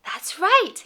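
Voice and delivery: put-on high, loud, singsong toddler talk; reading to a toddler. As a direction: beam at a little child and coo. Talks to toddlers, animal sounds.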